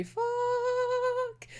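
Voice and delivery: mock-horrified little curse; dismayed curse. Character realises the truth.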